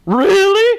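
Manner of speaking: high, loud chortled exclamation; chortling. A narrator's reading. Uncle Albert responds to Bert's joke, chortling high. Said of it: "chortled Uncle Albert".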